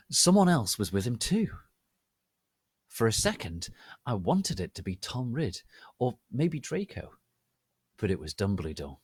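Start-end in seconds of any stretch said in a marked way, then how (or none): none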